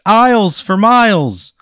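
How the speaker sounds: high, loud, nervous local ad voice; nervous TV pitchman. Acted. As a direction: play an awkward local TV salesman. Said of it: "He goes".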